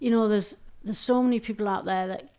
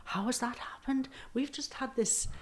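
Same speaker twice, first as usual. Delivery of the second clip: surprised whisper; 5 semitones higher, 8 dB quieter than usual. Retold or imitated. Audience reacting to her talk.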